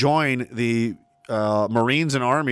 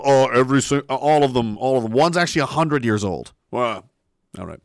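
usual speaking voice

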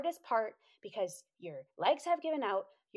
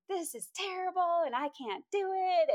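high, hammed-up complaint; mock wailing; 9 semitones higher than usual. Struggling hiker complaining to her amused friend, mock wailing. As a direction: moan it high, exaggerating the despair.

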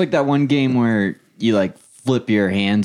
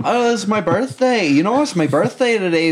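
birthday customer; 7 semitones higher than usual. Pretend.

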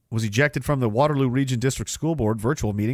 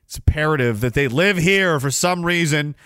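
normal talking voice

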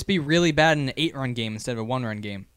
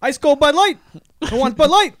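high, loud vendor call; stadium vendor hawking; 10 semitones higher, 6 dB louder than usual. Pretend. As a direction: hawk beer like a ballpark vendor. Mock stadium vendor calls.

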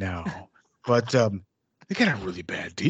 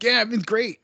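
bright, higher mock-cheerful fiddler; cheery fiddler voice; 9 semitones higher than usual. Mocking the wagon-train fiddler, chirpy and higher. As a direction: play the carefree pioneer fiddler, all is well.

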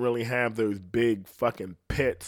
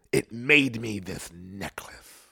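mock whisper; 3 semitones lower, slower than usual. Mock parent whispers boast.

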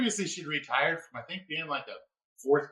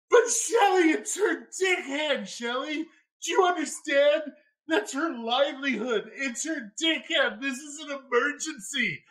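mock urgent shout; 10 semitones higher than usual. Narrator yelling at partner Shelly, mock-panicked high shout. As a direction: shout it like a crisis, slowly on dick hand. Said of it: "And I'm like".

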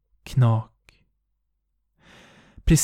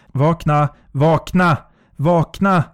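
from firm to higher, louder yells; rising panicked cry; building shout; 3 semitones higher, 4 dB louder than usual. Acted. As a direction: force yourself awake, louder each time. Trying to wake herself up.

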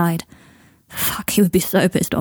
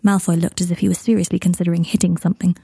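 plain reading voice

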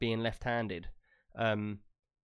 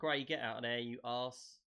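much softer Get out of there, barely a murmur; meek not-shouting; 11 dB quieter, slower than usual. Retold or imitated. Imagining mild Trub warning his friend, very soft. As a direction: say it gently, not a shout at all. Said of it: "he was probably more like".

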